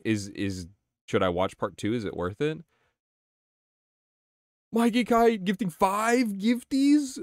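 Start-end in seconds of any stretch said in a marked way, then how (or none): 4.7-7.2 high, slow, amazed read of the alert; excited. Real, not acted. Streamer reading a gift alert to chat, amazed. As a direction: stretch it out in delight.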